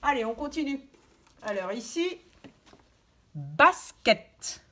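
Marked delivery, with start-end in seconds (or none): 3.3-4.6 «basket» said loud and distinctly; clear model word. Shown as a demonstration. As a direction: articulate «basket» for listening. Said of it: "on dit juste les mots". («basket» articulé fort)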